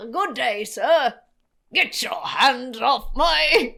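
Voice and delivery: loud, hammed-up Australian accent, slow and laughing; broad Australian ocker voice, breaking into laughter; mock Australian, slowed and laughing. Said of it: "ridiculous accent".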